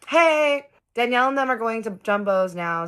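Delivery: high, loud acted call. Kristen coming out to tell them plans, calling out. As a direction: call out brightly to get their attention.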